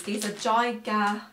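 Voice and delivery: badly put-on Australian twang; bad Australian accent. Vlogger showing viewers her barbecue marshmallows, in a bad Australian accent. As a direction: have a go at a hammy Australian accent. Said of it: "in a real bad Australian accent".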